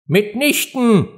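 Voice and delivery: high, drawn-out indignant denial. A narrator's reading. Mouse contradicts Alice's guess, indignantly. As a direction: protest slowly in high outrage. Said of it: "rief die Maus entrüstet".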